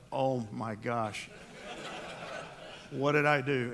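slow, laughing "oh my gosh"; laughing at himself. Retold or imitated. Dad laughing at his own blunder in the kitchen. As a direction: chuckle through the dismay. Realizing his slip in front of wife.

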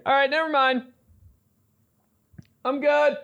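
mock bailing out in a high squeaky pitch; high-pitched backing out. Newlywed backing out of the marriage, high-pitched and mock-casual. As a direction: pitch way up for a playful, hasty retreat. Acting out instant change of mind.

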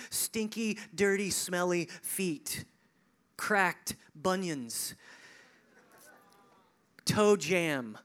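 slow, drawn-out gross details; relishing disgust. Acted. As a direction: linger on each nasty word. Savouring gross feet details.

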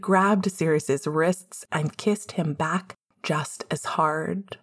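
ordinary talk, nothing marked

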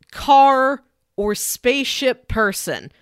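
normal talking voice

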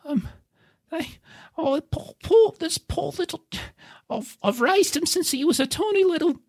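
high, fretful hobbit voice; sad hobbit owner. Acted. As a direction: fuss over the poor pony. Hobbit mourns his pony.

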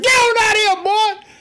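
very high, loud mock yell; hammed-up shout, throwing someone out; angry dismissal. Leaders throwing the man out of the synagogue, high angry yell.

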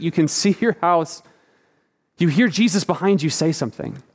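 ordinary delivery

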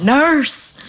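high imitation of a patient calling out; mock yell. Nurse mimicking the patient who scares her, high mock yell. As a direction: call it out high, mimicking him. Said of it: "Every time you yell out".

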